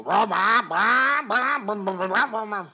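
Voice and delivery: loud high rah rah revving; engine revving noises. Retold or imitated. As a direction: rev it like a monster truck. Imitating monster truck engines.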